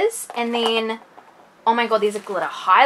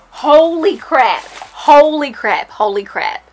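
excited; 3 semitones higher, 7 dB louder than usual. Real, not acted. Stunned by product.

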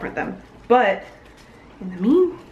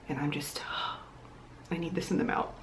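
weary whisper; 3 semitones lower, 12 dB quieter, faster than usual. Real, not acted. Sighing whisper about chores.

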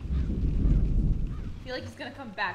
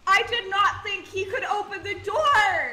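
laughing shout; 6 semitones higher, 6 dB louder than usual. Real, not acted.

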